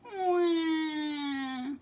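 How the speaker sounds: a cub's high sobbing wah; high wailing sound; high baby cry. Acted. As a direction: cry like a hurt little bear. Baby bear crying.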